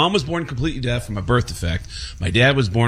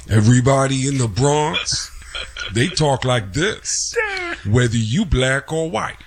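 Tracy Morgan voice; 2 semitones higher than usual. Retold or imitated. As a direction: do the Bronx guy the way Tracy Morgan talks. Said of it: "sounds like Tracy Morgan".

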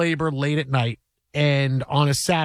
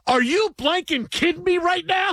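wife's furious shout; 12 semitones higher than usual. Retold or imitated. Wife in labor yelling at her yawning husband, outraged shout. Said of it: "she goes".

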